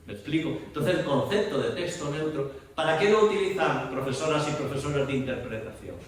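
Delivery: usual speaking voice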